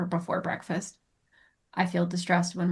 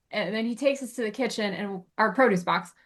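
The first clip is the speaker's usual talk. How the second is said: ordinary delivery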